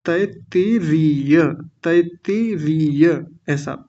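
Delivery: तैत्तिरीय drawn out slowly, each syllable clear, short first इ and long ई; teacher enunciating तैत्तिरीय very slowly. Shown as a demonstration. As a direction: spell out तैत्तिरीय slowly for the class. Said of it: "पहली छोटी ई की मात्रा है, दूसरी बड़ी ई की मात्रा".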